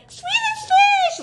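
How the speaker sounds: very high, slow squealed delight; kid-like squeal. Retold or imitated. Jumping up and down for her husband's candy, squealing.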